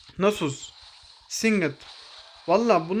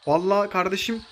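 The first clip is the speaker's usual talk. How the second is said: ordinary talk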